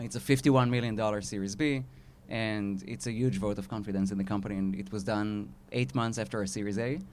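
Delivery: normal talking voice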